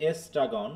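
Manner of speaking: এস্ট্রাগন beginning "es", then "tragon"; ESTRAGON with E. Shown as a demonstration. Teacher explaining the first letters, এস্ট্রাগন said as es-tragon. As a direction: open এস্ট্রাগন on a clear "es". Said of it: "এটা অনেকটা ই উচ্চারণ এ".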